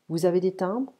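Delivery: "vous avez des timbres?" with pitch rising on "timbres"; rising question intonation. Shown as a demonstration. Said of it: "Intonation is important".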